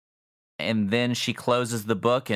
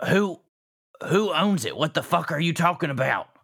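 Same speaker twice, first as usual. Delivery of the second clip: shouting; 9 semitones higher, 3 dB louder than usual. Acted. Aunt learns she lost retreat.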